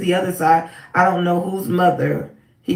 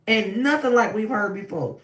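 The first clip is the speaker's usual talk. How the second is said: ordinary talk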